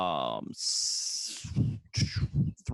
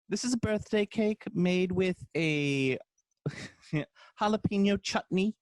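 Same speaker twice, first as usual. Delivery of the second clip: high, slow put-on presenter voice; mock British baker; 8 semitones higher, slower than usual. Host imitating baker presenting chutney cake, high mock British voice. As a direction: announce an absurd bake, pitched way up. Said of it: "they'll be like".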